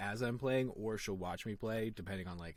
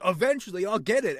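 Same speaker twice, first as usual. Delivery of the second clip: loud, high mock protest; defensive insistence; 8 semitones higher, 9 dB louder than usual. Player justifying rage to his wife, defensive insistence. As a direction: insist it hotly, hammed-up.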